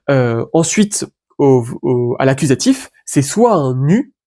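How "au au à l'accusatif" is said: ordinary delivery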